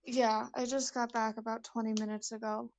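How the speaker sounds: ordinary talk, nothing marked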